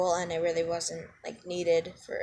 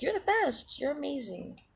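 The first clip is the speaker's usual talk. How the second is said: high-pitched, softly gushing praise; shower them in compliments, voice lifted high; sweet high voice that eases down; 5 semitones higher, 5 dB quieter than usual. Acted. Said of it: "shower you in compliments".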